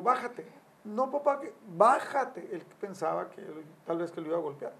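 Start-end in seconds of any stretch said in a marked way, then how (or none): 0.0-0.5 higher, firm command; stern order. Retold or imitated. Father ordering the son to come down, stern and raised. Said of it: "me pongo así". 0.8-1.4 a hint of a pleading boy, higher; lightly voiced son begging off; son protesting. Son protesting to his father, lightly voiced higher. 1.4-2.5 higher, firm command; stern order. Retold or imitated. Father ordering the son to come down, stern and raised. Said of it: "me pongo así".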